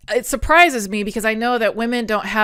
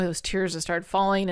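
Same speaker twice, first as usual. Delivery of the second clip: ordinary talk, nothing marked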